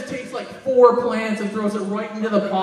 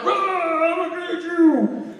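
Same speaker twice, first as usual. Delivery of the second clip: mock monster growl; 8 semitones higher, 4 dB louder, slower than usual. Imagined scene of friend panicking.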